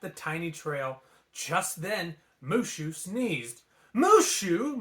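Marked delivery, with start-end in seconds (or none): 3.9-4.8 high loud startled gasp; startled cry of his name. A narrator's reading. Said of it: "Mulan gasped".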